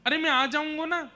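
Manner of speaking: put-on high-pitched, insistent young son's voice; child consoling mother. Krishna hugs weeping Yashoda, high-voiced earnest reassurance. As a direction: protest up high, pleading with her not to cry.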